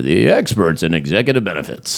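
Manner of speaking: slow, low over-eager announcer; infomercial voice. Retold or imitated. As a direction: ham up a deep commercial pitch. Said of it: "Is that your infomercial voice?".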